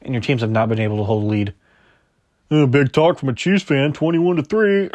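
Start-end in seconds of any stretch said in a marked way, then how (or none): 2.5-4.9 taunting rival-fan voice that breaks into a laugh; mock trash talk. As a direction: rib them like a gloating fan, then laugh. Mocking a rival fan's boast.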